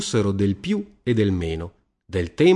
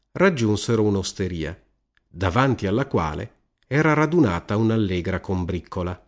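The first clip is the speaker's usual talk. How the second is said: ordinary delivery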